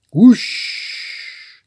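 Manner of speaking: breathy whispered whoosh; whooshing sound. A narrator's reading. Narrator voices the magic suitcase whoosh as a whisper. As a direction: blow it out like a rushing wind.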